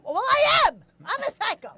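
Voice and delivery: high, loud outburst; defiant admission. Real, not acted. Woman answering being called a psycho, defiant. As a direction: own it loudly and proudly.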